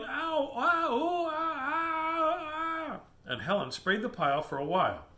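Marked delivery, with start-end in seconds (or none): 0.0-3.0 high-pitched drawn-out ows; yelling in pain. Acted. Something yelling under a pile of flies, high pained wailing. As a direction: wail "ow, ooh" way up high. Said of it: "something was yelling".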